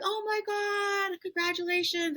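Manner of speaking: squealed high, imitating a delighted sister; a thrilled gasp turning into congratulations; excited surprise. Imitating her sister hearing the pregnancy news, high excited squeal.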